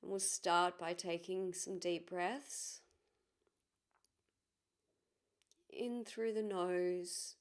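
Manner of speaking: calm, measured guiding voice with long pauses; guided meditation. Acted. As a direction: ease listeners gently inward, leaving space between phrases.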